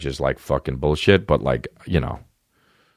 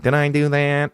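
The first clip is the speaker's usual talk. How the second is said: high nasal-free squeaky Urkel line, pitched up; Steve Urkel impression; 10 semitones higher than usual. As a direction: play the nerdy sitcom kid caught out. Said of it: "I used to do that impression".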